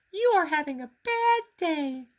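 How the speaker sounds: high, gentle teacher voice; Miss Ty. A narrator's reading. Miss Ty telling Grover he is having a bad day, gentle and high. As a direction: say it kindly as the teacher.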